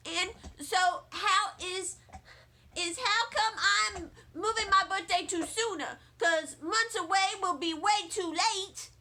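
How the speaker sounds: put-on high, pleading kid voice; a child arguing her case; little girl voice. Junie argues.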